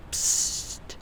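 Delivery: short, sharp psst to call a creature; a sly, secretive psst; hushed psst. Acted. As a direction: softly hiss a secret psst. Villain summons her hidden scorpion.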